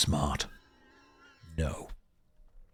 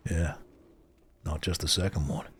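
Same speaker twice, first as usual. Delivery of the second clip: normal talking voice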